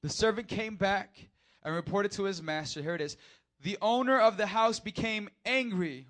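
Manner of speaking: loud, high pulpit reading; full-force sermon read; preaching at full force. Acted. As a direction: drive the verse home.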